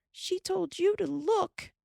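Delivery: high screaming retort. A narrator's reading. Carrie screams at Laura, high scream. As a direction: scream it back at her. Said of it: "Carrie screamed".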